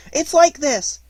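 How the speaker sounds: very high, loud, quick interjection; quick Piglet. A narrator's reading. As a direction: jump in hurriedly with a little high voice. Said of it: "said Piglet quickly".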